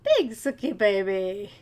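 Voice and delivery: slow fond pet croon. Real, not acted. Cooing at the cat again.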